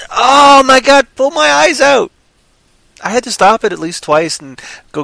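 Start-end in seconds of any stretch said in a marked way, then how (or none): 0.1-2.1 high, loud mock agony; hammed-up exasperation. Reviewer recalls watching the awful acting, exaggerated agonised exasperation. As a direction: cry out as if the film is torture.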